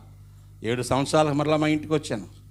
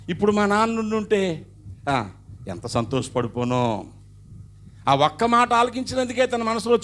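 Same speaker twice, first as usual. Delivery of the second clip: raised, fervent preacher voice; emotional preaching; 6 semitones higher, 4 dB louder than usual. Acted. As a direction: build the climax, high and loud.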